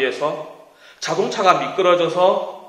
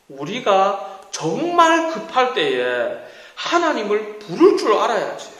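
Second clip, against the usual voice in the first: ordinary talk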